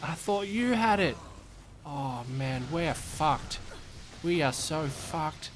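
put-on Australian accent, dread setting in; Australian accent, doomed worker telling his mate they're fucked; panicking Australian accent. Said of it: "You do a great Australian accent".

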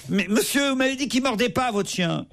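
a hint of a strident, alarmed man, laughing through it; half-laughing, mildly strident complaint; Gérard Miller's shrill voice. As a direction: lightly mimic a shrill, panicked pundit. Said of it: "avec sa voix stridente de Gérard Miller".

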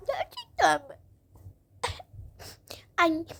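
put-on small crying child's voice; pretend tearful hope. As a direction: ask it through mock tears. Tearful child hears of new bed.

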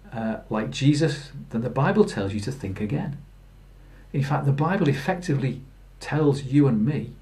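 ordinary delivery

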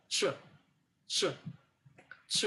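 "চ" as a short isolated English ch; ch sound demo. Shown as a demonstration. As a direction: release each "চ" alone, with a pause after. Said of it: "তখন এটার উচ্চারণ হবে". ("চ" আলাদা করে ছোট ইংরেজি ধ্বনি হিসেবে)

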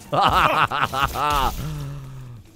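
long run of ha-has; gleeful laugh. Real, not acted. Goading enemies to shoot, long gleeful laugh. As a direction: let a long laugh roll out. Laughs while being shot at.

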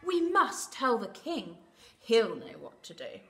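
loud, lightly voiced urgent hen; alarmed hen. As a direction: declare the plan firmly, as a lightly acted hen. Alarmed hen decides to tell king.

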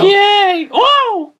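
very high squeaky cheer; Fluttershy voice. Pretend. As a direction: squeal like a tiny pony. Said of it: "Andrea Libman is in the room".

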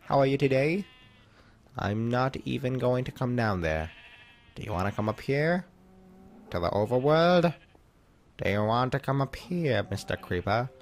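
hammed-up British gentleman addressing a creeper; playfully exaggerated British accent; mock British accent. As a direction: put on a silly, over-polite British voice. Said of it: "Why am I talking like that? I'm not even freaking British".

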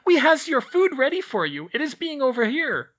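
very high, loud squeak; a tiny house-elf squeaking a greeting; squeaky elf. A narrator's reading. Elf greeting the children in a high squeak.

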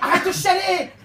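loud, high angry snap; angry yell. Acted. Mother yelling at her child to stop crying. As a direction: tell the kid to stop crying.

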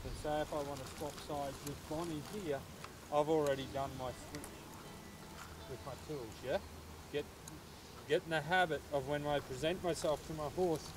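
usual speaking voice

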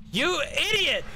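mock shrill insult; high squeaky idiot. Shown as a demonstration.

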